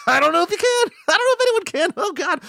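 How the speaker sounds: very high laughing squeak; squeaky high voice. Pretend.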